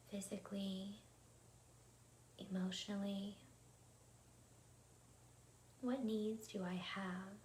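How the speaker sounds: calm, unhurried meditation voice with long pauses; guided meditation. Acted. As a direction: guide listeners gently into their breath, leaving room between phrases. Leads a guided breathing meditation.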